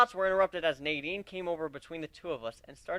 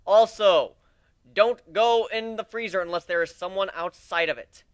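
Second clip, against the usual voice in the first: loud warning; 4 semitones higher, 8 dB louder than usual. Acted. Warning about the freezer.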